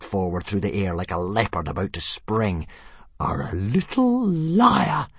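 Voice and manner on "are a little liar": higher, slow menacing hiss; hissing dragon. A narrator's reading. Fireworm stalking Toothless, slow menacing hiss. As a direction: creep the words out like a leopard. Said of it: "hissed Fireworm".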